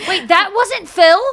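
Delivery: loud, high, drawn-out astonished question; shocked disbelief. Real, not acted. Friend learning the arrest photo isn't Phil, shocked. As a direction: blurt it out, stunned.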